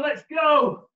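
high, loud coach yelling; shouting encouragement. Real, not acted. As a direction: fire them up for the last sprint. Final sprint, coach yelling encouragement.

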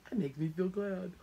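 showing a happy glad voice; cheerful glad-monster line; glad demo. Shown as a demonstration. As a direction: say it like you feel glad. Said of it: "Like this".

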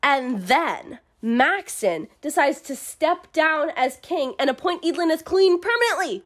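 ordinary talk

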